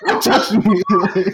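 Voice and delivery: high, whiny pretend crying; hammed-up tearful protest; mock crying. Acts out crying at movie.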